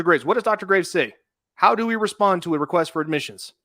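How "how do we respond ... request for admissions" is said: usual speaking voice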